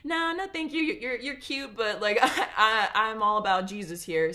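high, giggly polite brush-off; girlish no-thank-you with a laugh; Agatha politely refusing. Retold or imitated. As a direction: turn him down sweetly, laughing. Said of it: "And she says".

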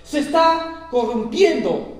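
loud, fervent scripture reading; booming pulpit delivery, slowed on the key words; preaching at full force. Acted. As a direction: proclaim God's rebuke to the congregation. Preacher reads God's words loudly.

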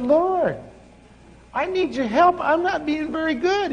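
put-on high, pleading little-boy voice; whiny plea. Preacher retells begging God for help, high whiny plea. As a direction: beg the Lord like a frustrated kid.

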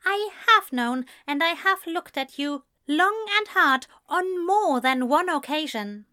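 high, emphatic rejection; indignant Ezra. A narrator's reading. Man turns down friend's love, indignant. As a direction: drive each point home, high-pitched.

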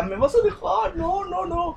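voz de niño mucho más aguda, suplicando; niño asustado. Retold or imitated. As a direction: ruego agudo de niño miedoso. Niño con miedo a quedarse. (niño preguntando a su mamá si lo va a dejar, muy agudo)

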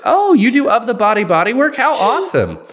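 high, loud gush of fake enthusiasm; mock gushing. Joking as an eager customer to a colleague, mock gushing. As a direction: pretend to be thrilled by a silly offer.

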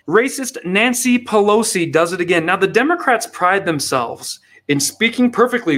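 ordinary talk

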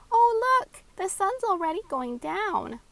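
put-on high, loud delighted little girl; excited. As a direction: gasp at the sunset with delight. Little girl sees the sunset.

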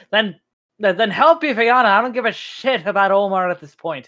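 high, loud, fast and stumbling; urgent, heated demand; frantic angry outburst. Acted. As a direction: burst out desperate and angry. Half elf frantic for wounded wife.

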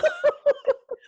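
a high, loud burst of laughter; loud, high-pitched laugh; real laugh. Real, not acted. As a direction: crack up at the I Love Lucy idea. Laughs at I Love Lucy comparison.

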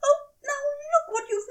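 high, whiny lament over the spill. Acted. Aziraphale lamenting his wine-stained waistcoat, mock-tragic. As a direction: wail it like a ruined treasure. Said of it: "he lamented".

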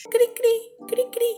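tiny very high chirping; high-pitched cri-cri noise; cricket chirps. Acted. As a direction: imitate crickets in an awkward silence. Imitates crickets in the silence.

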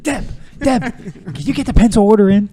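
high, very loud office holler; mock calling out. Mock office call to Deb.

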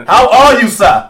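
loud, high put-on African accent. Host greeting the special guest, loud mock African accent. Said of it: "talk with an African accent".

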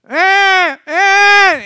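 high, loud imitated baby wail; newborn cry. Newborn finally crying in the delivery room, high wail. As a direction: mimic a baby's first cry.

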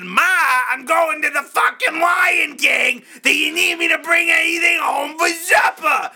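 high, exasperated mock shout to Ma; comic high-pitched holler; hammed-up yelling. Repeats louder for mother who didn't hear.

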